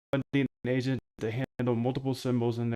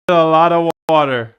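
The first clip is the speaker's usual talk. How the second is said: much louder, higher, falling at the end; loud exclamation; 5 semitones higher, 15 dB louder than usual. Real, not acted. Streamer calling out his one rule, loudly. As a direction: belt it out with a falling finish. Calls out the phrase again.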